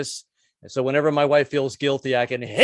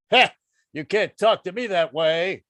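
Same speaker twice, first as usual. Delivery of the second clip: mock macho; 3 semitones higher than usual. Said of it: "I've tried the macho thing".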